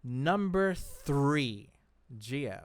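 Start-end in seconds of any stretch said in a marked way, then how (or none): none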